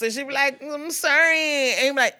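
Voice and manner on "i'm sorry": high, loud, drawn-out mock whine; hammed-up soap opera pleading; Alex, whiny apology. Cheating wife to her husband, melodramatic whiny apology. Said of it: "dialogue between Alex and her husband".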